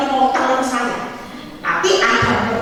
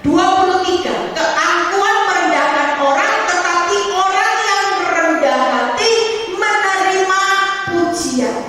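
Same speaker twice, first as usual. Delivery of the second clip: high, ringing preacher's recitation; scripture reading; 7 semitones higher than usual. Acted. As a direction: proclaim the verse in a raised singsong. Reads scripture aloud while preaching. (membacakan ayat Alkitab dengan nada tinggi)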